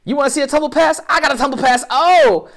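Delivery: high, loud, excited mock bragging; hammed-up boast. Host voicing a competitor showing off a tumble pass, hammed-up excited boasting.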